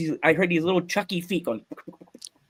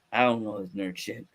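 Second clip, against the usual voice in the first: low, quieter dismissive dad; 6 semitones lower, 6 dB quieter than usual. Retold or imitated. Dad dismissing son's flight suit, imitated low and dismissive. Quotes his dad's dismissive reaction.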